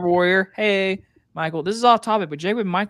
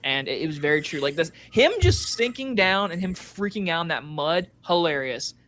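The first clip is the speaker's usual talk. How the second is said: ordinary talk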